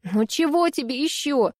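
sulky tearful reply; lightly voiced offended sniff; sniffling, hurt. A narrator's reading. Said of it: "обиженно хлюпнула носом Ани".

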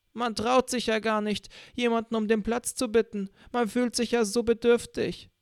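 put-on high whiny old woman; old lady voice. A narrator's reading. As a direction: play the self-pitying old lady, high-pitched.

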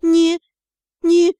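moaned stammer.

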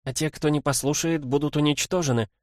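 high-voiced girl adding a stern warning. A narrator's reading. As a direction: lay down the law, pitched high. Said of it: "И сурово добавила". (сурово, высоким голосом)

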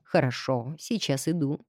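low, soft cooing reply; sweet cooing. Acted. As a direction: coo it softly and sweetly. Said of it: "милым голоском проворковал".

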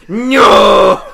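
very loud, high drawn-out "no"; mock outraged yell. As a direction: bellow it long and high. Repeat of mock outcry.

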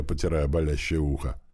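ordinary delivery, nothing marked

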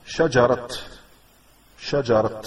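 "شجرة" ending in a sounded t, shajarat; stopped on open t. Shown as a demonstration. Teacher showing a pause on the Quran's spelling, "شجرة" ending in t. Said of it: "لو وقفت على شجرة".

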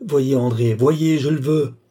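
loud, insistent order, slowing at the end; urgent command. Acted. Balsamo ordering his entranced seer to look, urgent command. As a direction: command with full force of will.

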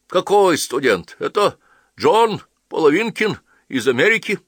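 indignant correction. A narrator's reading. Husband correcting his wife about the guest, high and loud. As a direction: set the record straight.

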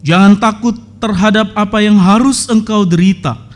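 loud, measured Bible reading; solemn scripture reading. Acted. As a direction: proclaim the verses to the congregation. Reads scripture aloud. (pembacaan Alkitab yang khidmat dan lantang)

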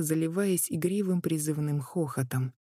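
usual speaking voice

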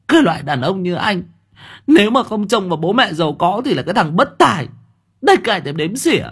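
high, fast scornful retort; defiant wife. A narrator's reading. Wife to husband after the slap, high scornful retort. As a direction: spit contempt back at him.

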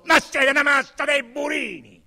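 high, loud furious threat; the irritated inspector threatens his men, shouting; threatening outburst. Acted. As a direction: blast them as a pack of bumpkins. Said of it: "minacciò".

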